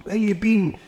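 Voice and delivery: high-pitched impatient boss, retold; Fergus. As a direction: imitate a boss demanding an answer. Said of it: "and Fergus going".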